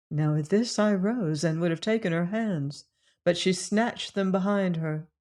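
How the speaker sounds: ordinary delivery, nothing marked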